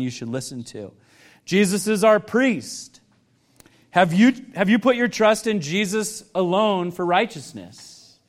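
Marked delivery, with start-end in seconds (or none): none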